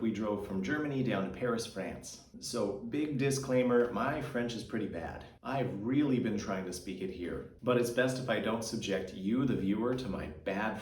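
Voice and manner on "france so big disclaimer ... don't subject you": usual speaking voice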